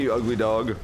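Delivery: usual speaking voice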